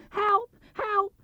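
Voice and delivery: very high panicked squeal; terrified Piglet. Acted. Piglet fleeing the heffalump, high panicked cry. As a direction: shriek for help in terror. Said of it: "cried Piglet".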